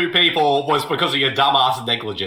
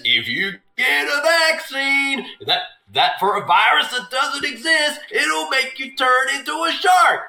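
ranting conspiracy nut; 8 semitones higher than usual. Pretend. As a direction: rant it out like a crank.

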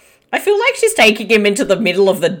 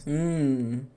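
usual speaking voice

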